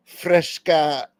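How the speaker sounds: ordinary talk, nothing marked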